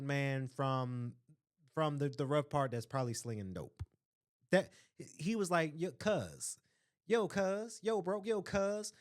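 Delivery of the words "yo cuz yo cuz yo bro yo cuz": lightly imitated streetwise boy, higher pitch. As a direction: mimic a kid talking tough in slang.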